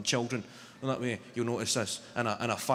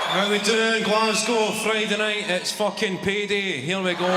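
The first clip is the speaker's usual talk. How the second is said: loud, higher, revved-up crowd call; comic rousing a Glasgow arena audience, loud and high; hyping the crowd; 7 semitones higher, 6 dB louder than usual. Real, not acted. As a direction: pump up the Friday night crowd.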